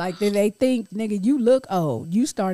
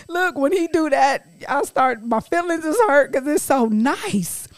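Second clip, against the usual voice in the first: ordinary talk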